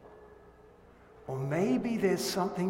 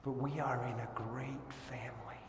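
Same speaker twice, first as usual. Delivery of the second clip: soft whispered reassurance, much softer; whispered tender reminder; 4 semitones lower, 10 dB quieter than usual. Acted. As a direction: comfort them in a hush. Whispered comfort after warning.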